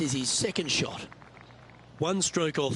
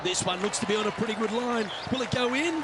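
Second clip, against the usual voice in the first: excited; 5 semitones higher than usual. Real, not acted. Live putt call rising.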